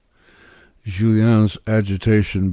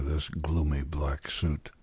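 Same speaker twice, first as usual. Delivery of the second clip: whisper; 3 semitones lower, 8 dB quieter than usual. Acted. Julien muttering to himself.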